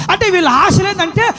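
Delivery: driving list that climbs to a high finish; impassioned preaching. Acted. Preacher listing longings to see and follow Jesus, impassioned. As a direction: build each longing up to a ringing cry.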